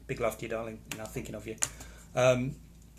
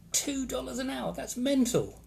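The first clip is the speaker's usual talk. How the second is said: high incredulous exclamation; 11 semitones higher, slower than usual. Real, not acted. Repeats wage in disbelief.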